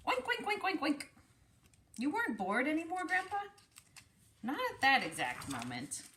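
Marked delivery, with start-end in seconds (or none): 0.1-1.0 high, squealy oink, oink, falling each time; pig oinks. Acted. Teacher voices the pig in story. 2.0-3.4 put-on higher, quick childlike question to Grandpa; child's voice. Child character asks grandpa.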